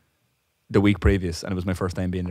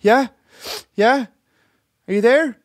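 much higher, louder ditzy customer voice; imitating the customer; 6 dB louder than usual. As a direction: mimic the confused customer on the phone. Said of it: "She's like".

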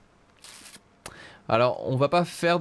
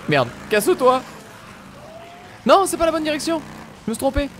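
panicked shouting; 12 semitones higher than usual. Real, not acted. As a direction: yell in a panic while fleeing. Fleeing zombies in panic.

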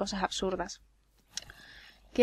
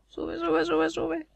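high, soft repeated urging; anxious urging; 5 semitones higher, 4 dB quieter, much slower than usual. Real, not acted. Player urging her drowning character to surface, anxious. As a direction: urge it upward under your breath.

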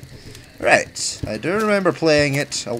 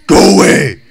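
shouting; 5 semitones higher, 12 dB louder than usual. Real, not acted. Yells at game monster.

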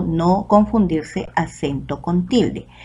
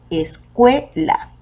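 slow "escuela" as es-CUE-la, CUE raised; split, stressed CUE; 4 semitones higher, much slower than usual. Shown as a demonstration. Teacher showing the stronger syllable in "escuela", stress on CUE. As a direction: stretch "escuela" by syllables, punching CUE higher. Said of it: "la que tiene mayor fuerza de voz".

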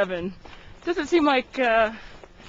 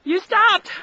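teasing shout; 8 semitones higher, 8 dB louder than usual. Real, not acted. Teasing call-out.

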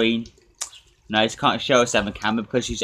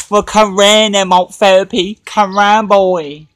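much higher, much louder challenge; taunting shout; 10 semitones higher, 12 dB louder, slower than usual. Real, not acted. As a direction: yell the dare right at him, come on then. Challenging rival to come round.

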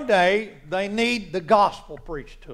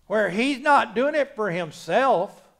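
ordinary talk

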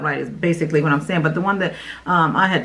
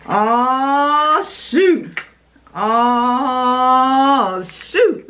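excited exclaim; 4 semitones higher, much slower than usual. Real, not acted. Excited at the product.

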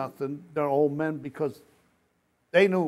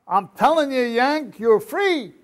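higher, louder announcing cry; insistent shout; 9 semitones higher, 10 dB louder, slower than usual. Retold or imitated. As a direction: shout the news to disbelieving men. Captain insists they're liberated.

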